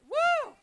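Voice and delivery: very high, loud shout of joy; real preacher's joyous whoop; joyful whoop. Real, not acted. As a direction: let out a happy holler. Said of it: "I preached me happy".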